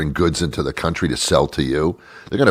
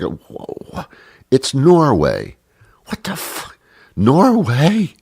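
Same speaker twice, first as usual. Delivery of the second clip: mock disbelief; 6 semitones higher than usual. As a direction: act totally floored, pitch jumping up. Mock bafflement at Norwegian listeners.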